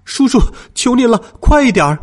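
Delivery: high, desperate plea to hurry; pleading child, pressing hard; urging. A narrator's reading. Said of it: "我催促道".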